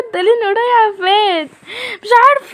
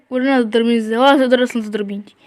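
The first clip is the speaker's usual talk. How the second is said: low, fast mocking taunt with a laugh; 10 semitones lower, 4 dB quieter, faster than usual. Giant dad taunts his wife, low mocking taunt. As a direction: sneer down at her.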